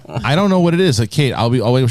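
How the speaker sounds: normal talking voice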